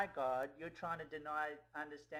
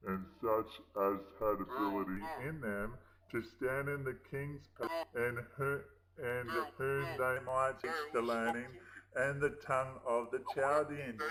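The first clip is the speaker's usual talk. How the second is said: solemn scripture reading; 9 semitones lower than usual. Acted. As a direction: intone the verse like a preacher. Reading Daniel scripture aloud.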